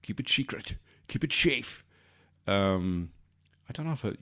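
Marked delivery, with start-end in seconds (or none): 0.0-1.8 put-on Scottish-accented wizard, s slurred to sh; mock Scottish brogue on a wizard's warning; Sean Connery. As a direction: do a hammed-up Scottish Gandalf, pitched up. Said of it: "Sean Connery in that role".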